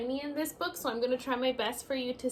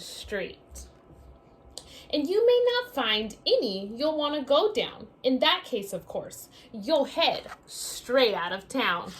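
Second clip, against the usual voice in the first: lively storybook read-aloud; reading to children; 6 dB louder than usual. Acted. Teacher reading a picture book to her class, lively read-aloud. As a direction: read it out like story time for little ones.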